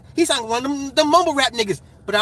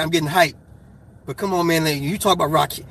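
usual speaking voice

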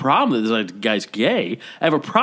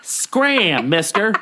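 put-on old-timey brush-off; 7 semitones higher, much slower than usual. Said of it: "I'm not from the fifties".

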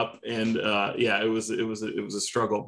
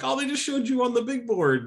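high-pitched imitation of excited onlookers; excited mock squeal of news; mock excited crowd; 14 semitones higher than usual. Voicing the others breaking the news.

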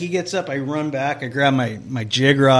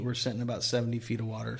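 ordinary talk

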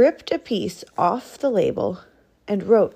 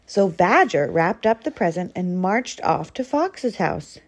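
usual speaking voice

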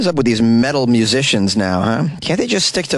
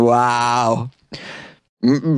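ordinary talk, nothing marked

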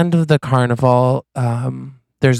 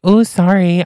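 high, louder fake-innocent sorry; mock apology; 7 semitones higher, 4 dB louder than usual. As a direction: pretend it was an accident. Feigned apology after dunking him.

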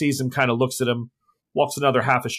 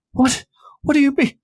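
panicked high denial; 4 dB louder than usual. Acted. Maurice after being accused.

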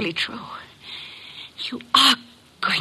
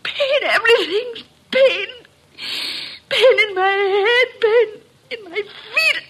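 high, cracking cries of pain; dying woman gasping out her pain; agonized cries; 7 semitones higher than usual. Acted. Dying patient cries in agony.

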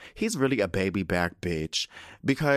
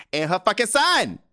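higher, louder, fed-up rant; mock exasperated yell; 12 semitones higher, 7 dB louder than usual. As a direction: blurt the order out like you've lost patience. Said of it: "he didn't say it like that".

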